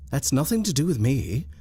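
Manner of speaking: ordinary delivery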